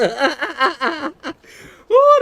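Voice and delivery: high, loud cackling laugh; high cackle. Real, not acted. Streamer laughing at a chatter, high loud cackle. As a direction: crack up in high bursts. Cracks up again.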